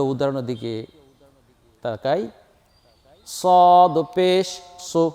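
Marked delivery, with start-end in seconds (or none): 3.3-5.1 slow, sung, louder with a thick full sad; heavy sad letter. Shown as a demonstration. Teacher showing heavy pronunciation of sad, chanted slowly. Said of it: "সদ হরফটাকে আমরা মোটা করে উচ্চারণ করব".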